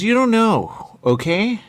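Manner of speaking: loud, high, indignant retort; mock exasperated yell. Quoting him fed up, loud and high. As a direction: snap it back loud and high. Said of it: "He says".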